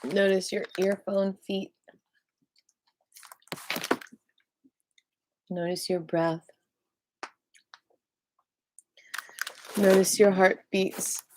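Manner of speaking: slow, calm breathing cues; guided meditation cue. Acted. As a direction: guide their attention gently.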